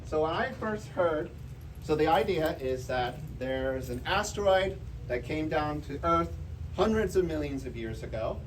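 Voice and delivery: ordinary talk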